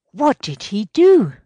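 loud, high, urgent demand; eager question. Acted. Superintendent asks about Mikhayev's surprising actions, eager. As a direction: jump in loudly, wanting to know.

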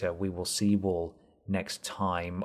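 ordinary delivery

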